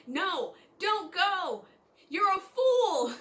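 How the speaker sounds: drawn-out, high mock scream; internal scream. Girl inwardly begging herself not to go, mock screaming. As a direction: wail a slow, high warning. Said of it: "internally screaming".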